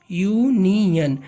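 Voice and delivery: यूनियन said slowly with a clear यू start; slow teacher's model of यूनियन; slow model word. Shown as a demonstration. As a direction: draw out यूनियन for the class. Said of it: "यू, ऊ नहीं निकल रहा है".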